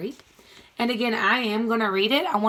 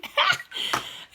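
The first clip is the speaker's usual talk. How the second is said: breathy laugh; 4 dB quieter than usual. Real, not acted. Laughs again at pun.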